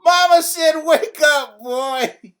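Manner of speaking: loud high shout in his ear; yelled. A narrator's reading. Little brother yelling to wake Tyrone, loud high shout. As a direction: yell to jolt him awake. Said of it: "and yelled".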